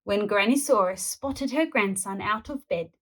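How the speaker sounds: plain reading voice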